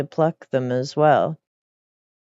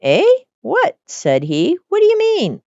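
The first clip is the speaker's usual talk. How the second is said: high, squeaky startled question; master's high startled squeak; 14 semitones higher, 4 dB louder than usual. Acted. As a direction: jolt up in shock.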